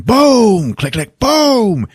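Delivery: high, booming sound effects, drawn out; hammed-up action noises; imitated gunfire. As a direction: voice the shotgun blasts and pumps. Imitates shotgun blasts and pumping.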